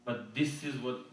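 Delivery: ordinary talk, nothing marked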